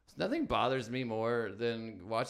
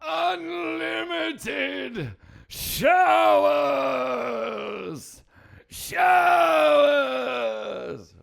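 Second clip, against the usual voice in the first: drawn-out, much higher, much louder yell; mock Palpatine shout; 13 dB louder, much slower than usual. Comedian shouting the joke's punchline in a mock Palpatine roar. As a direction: bellow it like a cackling emperor.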